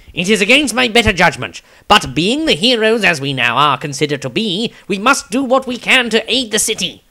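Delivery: high-pitched earnest halfling; Tobias the halfling. Acted. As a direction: lift the pitch for a plucky small hero. Tobias speaks in story.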